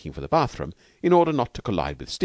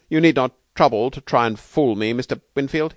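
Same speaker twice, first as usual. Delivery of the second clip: tart rebuke, higher and louder; 7 semitones higher, 6 dB louder than usual. A narrator's reading. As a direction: cut the pretence short, tartly. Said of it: "he said tartly".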